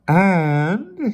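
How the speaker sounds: high, loud strained word. A narrator's reading. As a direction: strain upward on one drawn word. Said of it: "in a strained voice".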